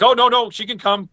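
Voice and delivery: high, quick reassuring no-no; eager insistence. Retold or imitated. Band insisting wife can come.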